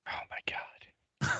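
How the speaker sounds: breathless whispered oh my God; shocked whisper. Real, not acted. Co-host horrified by a crude joke, whispering. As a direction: gasp it out under your breath. Gross-out reaction to co-host's joke.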